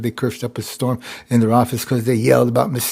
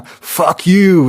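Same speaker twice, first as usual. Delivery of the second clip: loud, higher angry swear; angry curse; 5 semitones higher, 7 dB louder than usual. Shown as a demonstration. Correcting himself on what angry cursing is, loud and angry. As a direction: hurl the curse louder and higher. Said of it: "is an angry curse".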